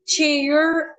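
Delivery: "chair" in an American accent, final R sounded; American-style "chair", ending on a held hook R. Shown as a demonstration. Teacher giving an example of Americans' R sound, American accent. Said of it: "they're having this R sound".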